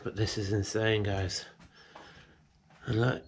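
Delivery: ordinary delivery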